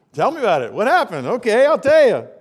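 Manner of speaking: loud, high, excited asking; mock eager outburst. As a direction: burst out eagerly. Retold eager request and answer.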